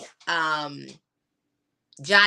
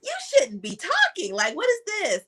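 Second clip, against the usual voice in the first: much higher, incredulous retold reaction; retold astonishment; 11 semitones higher than usual. Mother recalls reacting to her talking newborn, astonished. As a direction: react in high-pitched mock disbelief.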